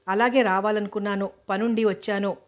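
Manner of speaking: ordinary delivery, nothing marked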